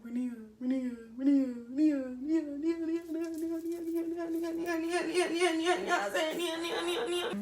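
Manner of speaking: rising, whiny string of her name; pleading boyfriend. Retold or imitated. Boyfriend caught lying, pleading her name in a mock whine.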